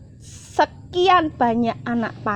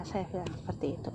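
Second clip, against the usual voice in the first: unvoiced, much softer whisper; whispered aside; 6 semitones lower, 18 dB quieter, faster than usual. Real, not acted. After retelling her prayer, a whispered aside.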